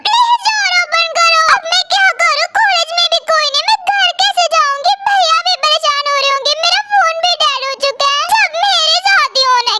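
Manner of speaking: put-on high, anxious, pleading girl's voice; frightened student locked in, begging someone to open the door; scared girl trapped alone. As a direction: call out for help, afraid and near tears. Girl trapped in library, panicking.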